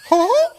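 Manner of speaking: high cartoon chuckle; Mickey Mouse laugh attempt. Shown as a demonstration. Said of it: "Yours sounds better than mine".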